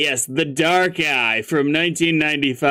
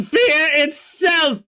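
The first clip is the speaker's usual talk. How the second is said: higher, slow, shouted punchline; triumphant shout; 3 dB louder, much slower than usual. Acted. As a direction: proclaim it grandly, slowly.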